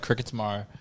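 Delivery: usual speaking voice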